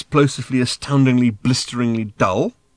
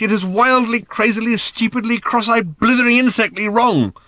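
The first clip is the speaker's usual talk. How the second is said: manic rant; 13 semitones higher, 7 dB louder than usual. Acted. Arthur's manic exhausted rant.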